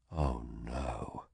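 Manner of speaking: hearing the bad news, breathed in shock. A narrator's reading. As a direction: let it out on a stunned breath. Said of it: "I breathed".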